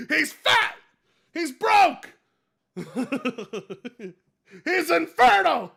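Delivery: high, loud jeering voice; mocking put-on voice. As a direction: jeer each insult loudly.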